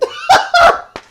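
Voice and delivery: loud, high burst of laughing; burst of laughter. Real, not acted. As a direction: shriek with laughter at the twist. Laughs at the absurd plot twist.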